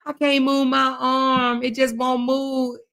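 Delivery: slow, drawn-out imitated complaint; mock helpless whining. Streamer imitating rival after arm workout, mock whining. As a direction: drag it out like a mock invalid. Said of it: "she be like".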